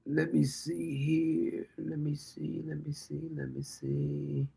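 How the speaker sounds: half to himself, trailing off; quiet muttering while scrolling; quiet searching. Real, not acted. Searching for news stories.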